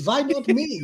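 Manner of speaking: put-on playful German-accented retort. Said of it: "Why am I doing a German accent".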